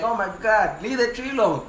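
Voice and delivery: fed up, set him straight; exasperated orders. Retold or imitated. Captain tells the sawyer to clear the house instead, exasperated.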